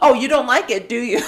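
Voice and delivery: gloating mom, hammed up; taunting mom. Sister voicing mom taunting her son, laughing mock taunt. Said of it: "It's like".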